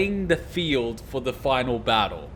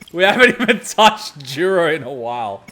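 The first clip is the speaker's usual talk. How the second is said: normal talking voice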